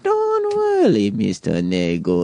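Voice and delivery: slow, breathy-free sultry parody of the masseuse; mock seductive masseuse. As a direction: coo it slowly like a seductive masseuse. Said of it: "She goes".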